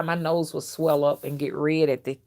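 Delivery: ordinary talk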